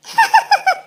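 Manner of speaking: high mocking cackle. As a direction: cackle behind her back. Crow laughs at bird. (चिढ़ाती हुई हँसी)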